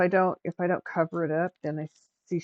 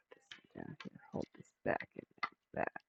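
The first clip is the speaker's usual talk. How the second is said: soft unvoiced whisper; 13 dB quieter than usual. Real, not acted. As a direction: whisper it to yourself. Muttering instructions to herself.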